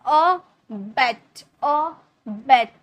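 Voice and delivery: अ, then a drawn-out, stressed बेट; stress on second syllable. Shown as a demonstration. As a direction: split the word slowly and lean on बेट. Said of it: "जोड़ दिया जाता है". (बेट पर ज़ोर देकर, धीरे)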